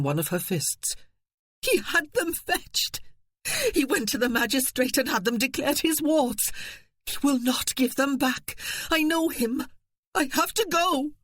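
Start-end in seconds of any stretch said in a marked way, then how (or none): 1.6-11.1 frantic. Acted. Wife frantic over children.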